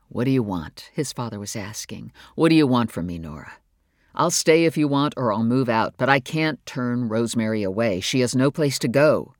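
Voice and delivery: low, firm fatherly voice; father's plea. Acted. Father asking his wife what she wants, low and firm. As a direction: hold your ground but plead.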